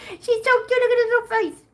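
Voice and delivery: very high cutesy squeal; tiny, squeaky adoring voice. Real, not acted. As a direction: gush over a kitten way up high. Repeats the coo much higher.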